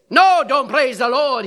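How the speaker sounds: loud, high outburst cutting him off; irate shout. Retold or imitated. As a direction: snap back loudly, imitating an angry caller. Imitates irate man on the phone.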